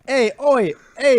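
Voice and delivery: put-on Australian accent. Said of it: "do an Australian accent".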